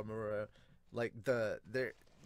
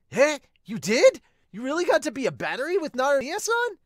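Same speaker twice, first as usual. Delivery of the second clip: much higher and louder, rushed disbelief; loud, fast, high excited shock; astonished Sawamura; 12 dB louder than usual. Acted. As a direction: squeal it in total amazement.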